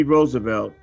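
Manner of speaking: normal talking voice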